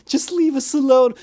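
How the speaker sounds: high, loud brush-off; hammed-up teenage shout; mock snapping teen. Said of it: "turn around and say".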